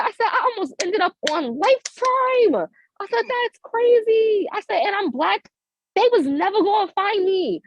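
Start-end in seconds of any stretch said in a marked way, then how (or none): 0.3-2.7 high, stretched-out comic amazement; amazed disbelief. Retold or imitated. Amazed at own near miss. 3.3-4.5 high, very drawn-out exclamation; amazed disbelief. Retold or imitated. Amazed at own near miss. 4.8-7.6 high-pitched comic insistence; emphatic exclamation. Retold or imitated. Comic exclamation about never being found.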